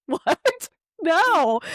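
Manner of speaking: high-pitched incredulous reaction. Retold or imitated. Retelling shocked reply to husband.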